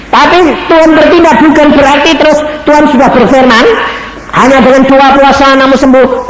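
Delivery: high, loud preacher's declamation; fervent sermon voice; preaching at full force. Acted. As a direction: preach it out to the congregation. Preacher raises voice to congregation.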